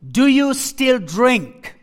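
slow, pointed question, voice pushed up; angry question. Retold or imitated. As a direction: press him slowly with a rising edge. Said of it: "suddenly I became so angry".

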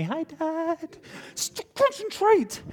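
put-on high, light little girl's voice, then a slow self-reminder; a girl waving at dad mid-race; cheerful young daughter.

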